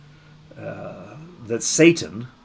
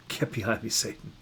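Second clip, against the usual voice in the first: softer, settled and unhurried; gentle but resolute; quiet firm rebuke; 10 dB quieter than usual. Shown as a demonstration. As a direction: dismiss it calmly, no shouting. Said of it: "doesn't have to be about yelling".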